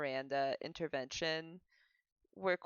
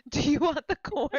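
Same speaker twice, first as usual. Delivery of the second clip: much louder, higher offer of corn; Ginger offering the corn loudly; 6 semitones higher, 10 dB louder than usual. Retold or imitated. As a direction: push the corn offer loud and eager. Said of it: "Ginger's like".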